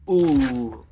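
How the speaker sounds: loud, drawn-out "ooh"; long wincing "ooh" of onlookers; imitated crowd wince. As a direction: react like a crowd seeing a fall. Imitates onlookers seeing her fall.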